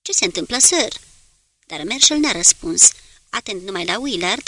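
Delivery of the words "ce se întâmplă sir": mildly concerned question, rising at the end; worried voice. A narrator's reading. Gerard asks the captain through the voice tube, mildly worried. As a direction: ask with a touch of worry. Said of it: "se auzi glasul îngrijorat al lui Gerard".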